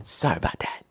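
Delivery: breathy murmured apology under the breath; quick hushed sorry; whispered aside. Real, not acted. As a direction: mutter the apology off to the side. Whispered aside after slip.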